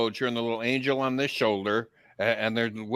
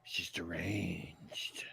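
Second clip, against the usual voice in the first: very slow, drawn-out whisper; hushed, stretched-out verdict; stunned whisper; 7 semitones lower, 16 dB quieter, much slower than usual. Real, not acted. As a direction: whisper it low in disbelief. Stunned reaction to the streamer's claim.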